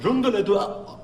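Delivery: hammed-up gibberish French, high and nasal-free; mocking how French sounds, rising to a shout. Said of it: "But French is like".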